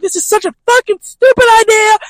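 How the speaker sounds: high, loud furious rant; shouting in disgust; angry yell. Retold or imitated. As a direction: spit it out in a rage. Nico raging at door.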